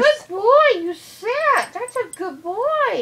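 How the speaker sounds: high, loud praise for the dog. Real, not acted. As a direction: gush over a good dog. Praising the dog after sit.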